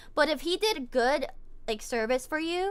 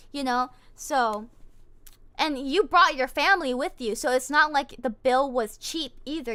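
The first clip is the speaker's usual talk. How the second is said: ordinary delivery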